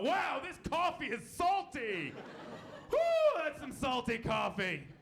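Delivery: high, drawn-out diner's holler; mock yell. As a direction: imitate an astonished diner whooping it out. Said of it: "and yell out".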